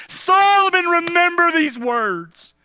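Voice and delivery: calls the name out high and loud, then slows; a father's urgent call across the room; loud charge to son. Acted. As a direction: Solomon rings out high, remember these words slow.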